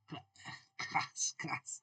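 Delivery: whispered, half to herself; a hushed whisper recalling the word. Real, not acted. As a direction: whisper the word while remembering it.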